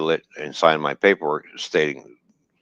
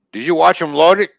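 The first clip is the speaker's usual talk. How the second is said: a sharp, much higher and louder official voice; officer's question; 10 semitones higher, 6 dB louder than usual. Retold or imitated. Retelling the customs officer grilling him, higher and louder. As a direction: bark it like a suspicious inspector.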